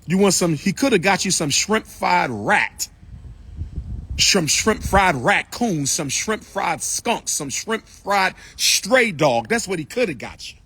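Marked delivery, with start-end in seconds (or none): 4.2-9.5 slow, drawn-out list of dishes; slow teasing rundown of the dishes; slow mocking list. Real, not acted. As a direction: savour each absurd dish slowly.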